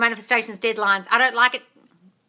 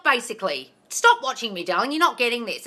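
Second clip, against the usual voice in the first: ordinary talk, nothing marked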